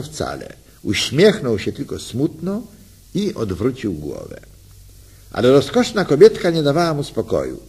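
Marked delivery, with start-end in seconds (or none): none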